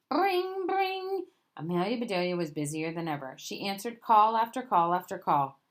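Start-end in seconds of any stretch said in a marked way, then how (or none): 0.1-1.3 high ringing "bring, bring"; phone-ring imitation. Reader voices the busy office phone, high ringing.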